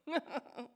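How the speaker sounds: short embarrassed giggle; nervous laugh. Real, not acted. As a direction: laugh off the nerves. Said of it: "Sorry, I'm a bit nervous".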